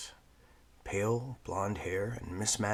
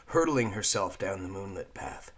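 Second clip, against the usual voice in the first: ordinary delivery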